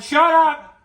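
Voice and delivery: loud, sharp snap; snapped shut up. Acted. To the one who keeps saying no, snapped yell. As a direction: cut him off hard.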